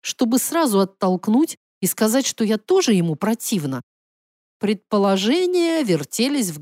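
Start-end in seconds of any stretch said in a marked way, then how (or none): none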